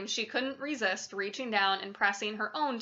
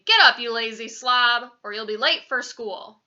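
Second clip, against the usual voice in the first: loud, scolding wake-up call; father's real voice; 8 dB louder than usual. A narrator's reading. Father wakes his son for school, loud real voice. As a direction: rouse a lazy child with force. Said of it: "my father's real voice saying".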